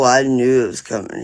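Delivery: ordinary talk, nothing marked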